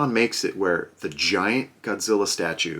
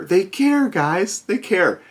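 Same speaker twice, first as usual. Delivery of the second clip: high, mocking exclamation; mock amazement that they care; sarcastic to viewers about the company; 10 semitones higher, slower than usual. Real, not acted.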